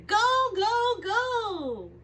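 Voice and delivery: loud high go go go; excited cheer. Acted. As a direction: cheer him on. Cheering the excavator.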